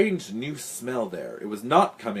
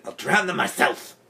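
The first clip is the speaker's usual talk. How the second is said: forceful, higher, vicious vow; furious threat; 5 semitones higher than usual. A narrator's reading. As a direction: spit out the threat in rage. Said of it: "Then she screamed".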